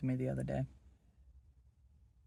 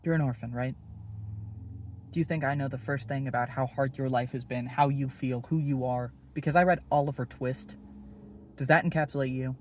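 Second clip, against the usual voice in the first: louder, hammering each question; angry challenge; 5 dB louder than usual. Acted. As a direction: corner him with each question. Attacking Will's assumptions.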